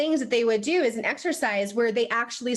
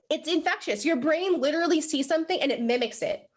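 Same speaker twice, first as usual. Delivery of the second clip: ordinary talk, nothing marked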